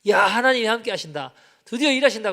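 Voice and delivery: excited exclamation. Retold or imitated. Praying pastor cheering as his friend is healed, excited. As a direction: announce the miracle with rising joy.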